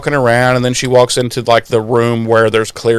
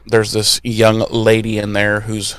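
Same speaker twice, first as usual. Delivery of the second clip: usual speaking voice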